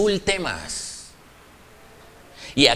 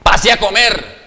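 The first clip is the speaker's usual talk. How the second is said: louder "pase a comer" with a hard, falling end; harsh command; 2 semitones higher, 6 dB louder than usual. Shown as a demonstration. Preacher showing the harsh tone, curt command. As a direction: bark "pase a comer" as an order. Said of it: "Son dos tonos diferentes".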